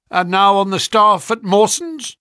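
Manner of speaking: loud, high businesslike question; brisk, sharp. A narrator's reading. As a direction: press on with the interview, loudly. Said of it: "spoke sharply".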